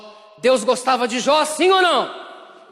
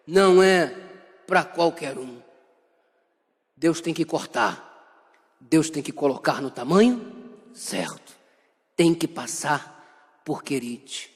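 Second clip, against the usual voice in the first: much lower and softer, slow and grave; hushed preaching; 9 semitones lower, 4 dB quieter than usual. Acted. Preacher quietly drives home God's pruning. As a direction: lean in and drop the voice to a hush. Preacher drops to intense low voice.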